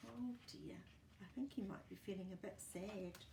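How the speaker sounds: ordinary delivery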